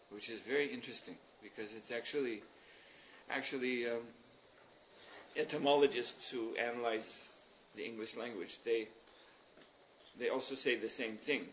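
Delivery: ordinary talk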